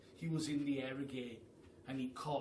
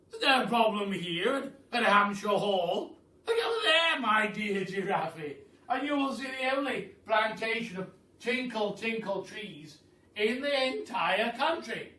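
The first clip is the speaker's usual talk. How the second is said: loud, grand aristocratic Duke, higher; booming Duke; 7 semitones higher, 10 dB louder than usual. Acted. The Duke welcomes the hungry giraffe, booming and grand. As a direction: boom it out like a jolly grand duke.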